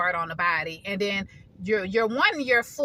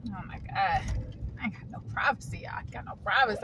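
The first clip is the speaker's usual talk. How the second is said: hushed; 8 dB quieter, faster than usual. Real, not acted. Annoyed, people around her car.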